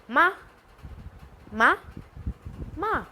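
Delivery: मा dropped sharply from high, meaning to scold; falling fourth tone. Shown as a demonstration. Teacher showing sharp falling tone for scold. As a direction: snap मा straight down. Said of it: "to scold".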